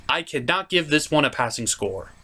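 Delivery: usual speaking voice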